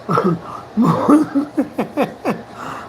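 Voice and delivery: long staccato laugh; real laugh. Real, not acted. Storyteller laughing at his own tough talk, amused. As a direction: laugh it out in a run of short bursts.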